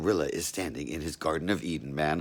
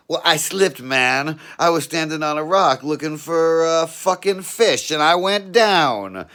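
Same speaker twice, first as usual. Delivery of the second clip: raised, louder pleading voice; nervous explaining; 8 semitones higher, 7 dB louder than usual. Acted. Caught naked in the bushes, pleading loudly with the cop. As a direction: talk your way out of trouble.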